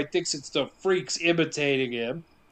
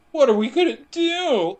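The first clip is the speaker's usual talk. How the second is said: higher, pleading whine; whiny voice; 8 semitones higher, 4 dB louder than usual. Retold or imitated. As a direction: whine it like a scared kid. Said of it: "In a real whiny voice".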